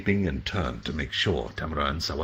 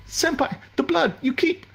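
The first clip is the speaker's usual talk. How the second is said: much higher, frantic and breaking; terrified for his bleeding senpai; panicked junior; 3 dB louder than usual. Acted. Tamura near tears over senpai.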